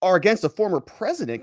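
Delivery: usual speaking voice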